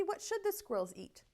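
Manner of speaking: ordinary delivery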